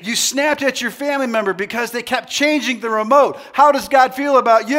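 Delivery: high, loud challenge to the crowd; hyped-up shout. Real, not acted. Preacher testing crowd on snapping at family, hyped-up shout. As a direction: fire the question out at full voice.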